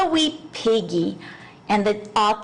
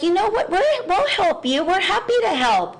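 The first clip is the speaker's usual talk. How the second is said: helpful insects; 8 semitones higher than usual. Retold or imitated. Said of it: "the fireflies and the ants are like".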